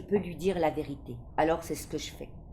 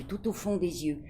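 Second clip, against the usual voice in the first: ordinary delivery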